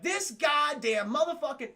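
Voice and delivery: mock losing it. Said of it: "he's lost. He's like".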